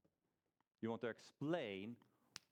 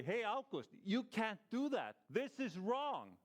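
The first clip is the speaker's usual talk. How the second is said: higher and slow, mock-earnest scolding; objecting friend; 9 semitones higher, 4 dB louder, slower than usual. Friend scolding August over the robbery, slow and raised. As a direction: play the friend wagging a finger at you. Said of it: "he will come to me and say".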